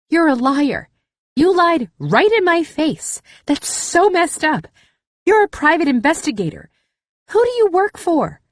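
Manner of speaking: high, loud, hurt outrage; indignant accusation. Acted. Cass berates the lying investigator, high shrill betrayed outrage. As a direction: call her out, betrayed.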